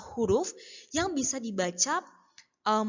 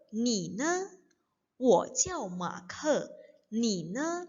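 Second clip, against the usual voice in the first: slow, evenly separated Mandarin syllables; read-along; much slower than usual. Shown as a demonstration. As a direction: model it slowly for learners to repeat. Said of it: "jadi kita baca bareng-bareng ya". (baca pelan agar bisa diikuti bersama)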